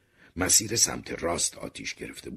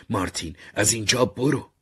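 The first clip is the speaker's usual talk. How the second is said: raised, louder wailing cry; wailing plea; 7 semitones higher, 7 dB louder than usual. A narrator's reading. Mother begging her son to flee, wailing. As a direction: wail it out as an urgent plea. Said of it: "مادرم شیون کنان گفت".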